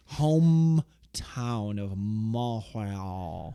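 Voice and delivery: long drawn-out French-style Montreal; milk the French name, slowly; mock French accent. Co-host announcing the match's hometown, hammed-up French accent.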